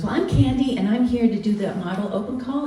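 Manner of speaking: mock the eager girl, pitch lifted; a hint of the bubbly hopeful; perky ingenue. Imitating eager model Candy.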